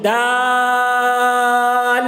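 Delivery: دال stretched very long, chanted; long held letter name. Shown as a demonstration. Teacher demonstrates دال for students, long and chanted. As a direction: hold دال out like a recitation. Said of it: "اس کی normal آواز ہے".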